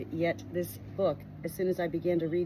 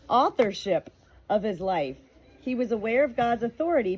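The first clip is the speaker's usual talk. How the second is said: clear, steady read-aloud voice, a little higher and brighter than her chat; 4 semitones higher, 3 dB louder than usual. Acted. Sharing a book's introduction with her viewers, in a clear read-aloud voice. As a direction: read the book's words out to your viewers, evenly paced.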